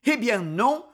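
ordinary delivery

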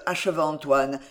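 ordinary delivery, nothing marked

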